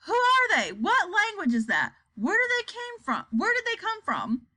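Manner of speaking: high, agitated questions; alarmed questioning. A narrator's reading. Frightened viewers asking one another, high and alarmed.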